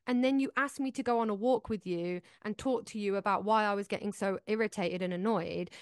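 ordinary talk, nothing marked

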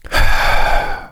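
long slow unvoiced exhale; audible calming sigh; deep breath out. Real, not acted. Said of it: "Let me take another deep breath".

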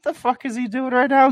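high, baffled outburst. Retold or imitated. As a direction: pitch it up in disbelief. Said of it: "I was like".

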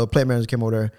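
ordinary delivery

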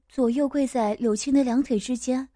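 plain reading voice

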